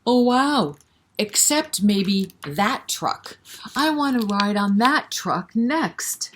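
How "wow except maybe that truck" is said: ordinary delivery, nothing marked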